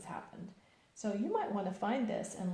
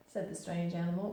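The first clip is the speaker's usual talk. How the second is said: ordinary delivery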